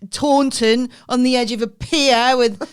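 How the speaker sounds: hammed-up weather-report link; mock news anchor. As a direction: announce it like a TV newsreader. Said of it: "so they go".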